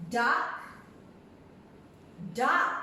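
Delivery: normal talking voice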